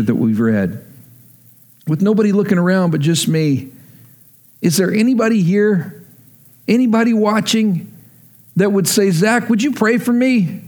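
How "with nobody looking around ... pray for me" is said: altar call preaching. Real, not acted. Pastor inviting the congregation to ask for prayer, urgent and pleading. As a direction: draw the room in, urging them to raise a hand.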